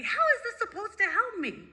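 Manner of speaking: high-pitched, incredulous complaint; exasperated protest. Retold or imitated. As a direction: wail the question in comic exasperation. Exasperated protest at repeated instructions.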